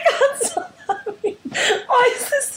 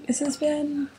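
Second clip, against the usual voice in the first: soft, hesitant intro; uncertain, feminine; 7 semitones lower, 6 dB quieter than usual. Retold or imitated. Podcaster mocking co-host's usual intro, uncertain and feminine. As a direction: mimic a timid, girlish host greeting. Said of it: "uncertainly and femininely".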